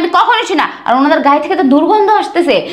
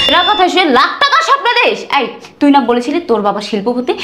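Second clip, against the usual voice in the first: usual speaking voice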